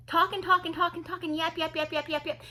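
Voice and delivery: high, monotone run of chatter; mimicking nonstop talking. Mocking a woman talking the whole time, nonstop chatter. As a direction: rattle the words off high, without a break. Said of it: "she was just talking the whole time".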